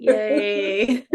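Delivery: drawn-out mock cheer; a fake little hooray through a laugh; sarcastic. Real, not acted. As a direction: cheer as if you don't mean it. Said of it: "I say that sarcastically".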